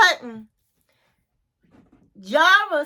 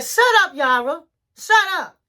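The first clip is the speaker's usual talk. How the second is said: higher, louder retold 'shut up'; sudden high bursts of mock irritation; mock angry snap; 5 semitones higher, 3 dB louder than usual. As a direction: imitate a husband silencing his wife. Retold husband snapping at wife.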